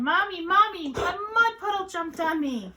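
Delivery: high, loud cry to Mommy; singsong high tattling yell; child yelling. A narrator's reading. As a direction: call out like an upset little kid. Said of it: "Julian ran inside yelling".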